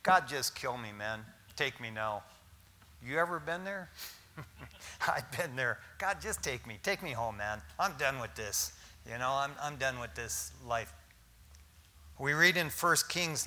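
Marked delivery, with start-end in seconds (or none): 0.0-2.2 weary despair. Retold or imitated. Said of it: "what he tells God". 6.0-10.9 weary despair. Retold or imitated. Elijah begging God to die.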